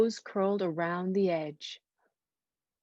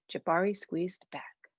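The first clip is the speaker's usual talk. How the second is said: plain reading voice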